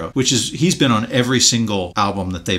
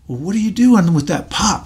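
a lightly voiced curious kid, higher; curious little boy; 8 semitones higher than usual. Retelling a five-year-old asking the shoeshine man, lightly voiced curious kid.

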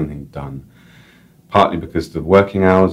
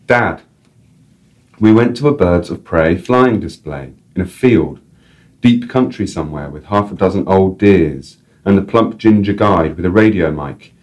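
measured literary reading to her late dad; story reading; 3 semitones higher, 4 dB louder than usual. A narrator's reading. Author reading her story aloud to listeners. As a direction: read it out like a letter.

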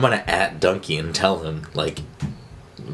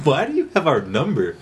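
laughing; 6 semitones higher than usual. Retold or imitated. Laughing mock quote.

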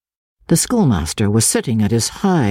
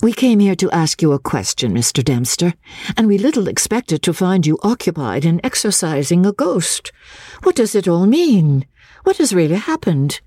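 lady's voice; 7 semitones higher than usual. Acted. Miss Halcombe questions master.

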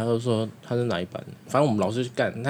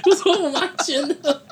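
talking through giggles, higher; laughing; 4 dB louder than usual. Real, not acted. Retelling teacher's questioning while laughing. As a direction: keep laughing as the story goes on.

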